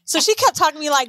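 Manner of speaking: ordinary delivery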